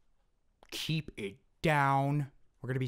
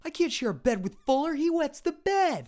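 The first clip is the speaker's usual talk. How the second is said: put-on high, loud taunting kid; bratty kid voice; 14 semitones higher, 9 dB louder than usual.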